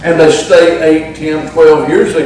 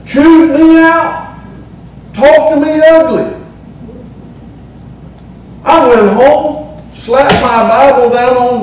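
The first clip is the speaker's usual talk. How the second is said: indignant; 8 semitones higher than usual. Real, not acted. Indignant retelling of scolding.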